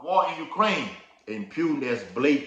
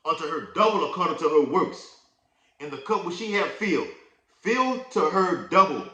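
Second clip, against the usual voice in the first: loud, raised scripture reading; preaching scripture; 5 semitones higher, 6 dB louder than usual. Acted. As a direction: proclaim the verse to the congregation. Reads scripture aloud, preaching.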